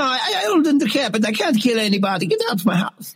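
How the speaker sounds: put-on high Italian old-woman voice, chuckling; a fussy old Italian lady stalling, with laughs; Italian granny. Old Italian killer offers the detective food, high accent.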